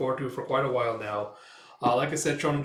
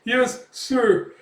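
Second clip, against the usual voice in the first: high, loud squeaky yes sir; cowering reply; 10 semitones higher, 7 dB louder than usual. Acted. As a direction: yelp it out terrified. Thug cowers under Guts.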